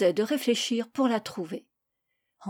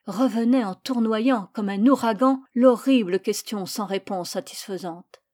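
ordinary delivery

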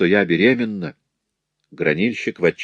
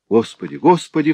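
much higher anguished cry; 8 semitones higher than usual. Acted. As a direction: cry out to God in despair. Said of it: "вскричал гранильщик".

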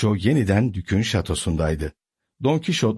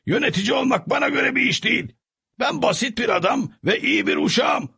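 high servant voice, calling out his choice; resolute Sancho; 14 semitones higher than usual. A narrator's reading. Sancho tells his donkey he's going home, high and earnest.

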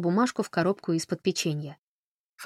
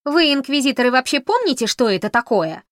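angry; 7 semitones higher, 8 dB louder than usual. A narrator's reading. Камила attacks the inquisitors.

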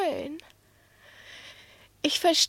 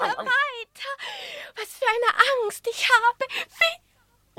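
high, eager, pressing for more; breathless excitement; 8 semitones higher than usual. Acted. Cat begs the dog to go on, excited. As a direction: hang on every word, thrilled and fearful. Cat thrilled by the dog's tale.

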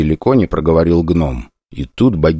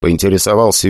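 plain reading voice

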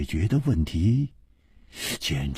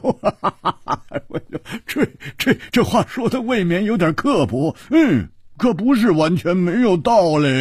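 high, loud, laughing through the words; roaring with laughter; 7 dB louder than usual. A narrator's reading. Friend reacting to the princess's harsh remark, laughing heartily. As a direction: laugh hard, stammer, then carry on high-pitched. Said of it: "胡铁花大笑".

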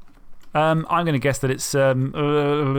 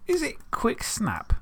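unvoiced, slow whispered guess; 7 semitones higher, 6 dB quieter, slower than usual. Real, not acted. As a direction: breathe the guess out timidly. Guessing answer quietly.

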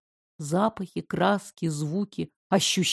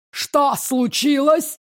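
growled; 5 semitones higher, slower than usual. Acted. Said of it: "прорычала женщина".

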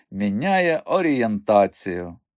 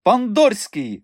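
high, drawn-out summons; imperious madam's high call; 11 semitones higher, 4 dB louder, slower than usual. A narrator's reading. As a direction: holler the name across the yard, stretched out. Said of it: "гукнула мадам Бухенбах імпресаріо".

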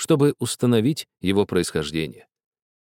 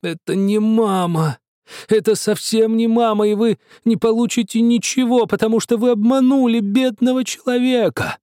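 high, louder, wailing protest; angry and plaintive; 12 semitones higher, 4 dB louder than usual. A narrator's reading. Shoemaker protesting the portrait to the painters, high angry wail. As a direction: whine it out furious and hurt. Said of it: "сказал злым и жалобным голосом".

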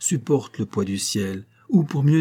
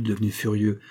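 plain reading voice

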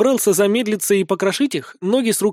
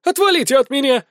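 high, panicky shriek; frightened squeal; 10 semitones higher, 4 dB louder than usual. A narrator's reading. Woman fleeing her attackers, squealing in fear. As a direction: squeal in fear to drive them off. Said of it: "визжит бабища от страха".

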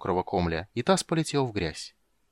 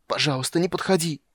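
desperate plea; 7 semitones higher than usual. Acted. Kender begs in horror.

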